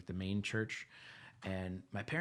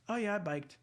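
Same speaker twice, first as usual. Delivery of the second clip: put-on higher, breezy little-kid answer; casual kid; 10 semitones higher than usual. Boy telling the teacher he biked, breezy and high. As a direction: shrug it off like a proud kid. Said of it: "kid answering the teacher".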